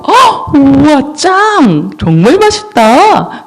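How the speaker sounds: high, wowed exclamations; hammed-up thrilled eater, drawing out the praise; acted delight. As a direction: gasp and gush over delicious food. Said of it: "아주 기뻐하고 있어요".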